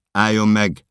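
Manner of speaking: high, loud command to halt; shouted warning. Acted. Officers ordering the runner to stop, shouted warning. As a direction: yell it as an order. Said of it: "kiabálnak".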